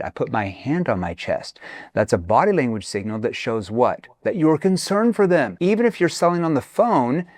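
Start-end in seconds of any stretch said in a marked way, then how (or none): none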